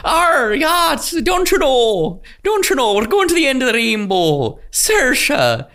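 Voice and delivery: high, loud hammed-up Irish brogue; bad Irish accent. Co-host playing Saoirse on a business call, hammed-up Irish brogue. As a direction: ham up a leprechaun Irish voice. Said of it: "tried to do an Irish accent".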